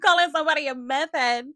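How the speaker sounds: high, loud laugh through the words; cracking up in disbelief. Real, not acted. Incredulous laughing outburst at Kim.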